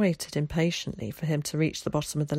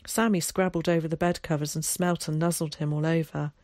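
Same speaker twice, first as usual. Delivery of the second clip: plain reading voice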